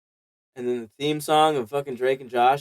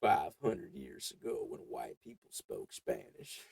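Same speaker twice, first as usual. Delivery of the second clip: mock ad narrator; 4 semitones lower than usual. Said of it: "And it's just like".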